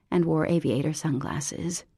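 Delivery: usual speaking voice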